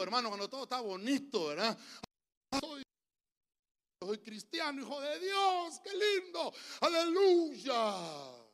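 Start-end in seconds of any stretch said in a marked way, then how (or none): 2.6-8.5 high, drawn-out gushing; mock blissful believer. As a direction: gush piously with exaggerated delight. Mock believer when all is lovely. (euforia piadosa exagerada, aguda y alargada)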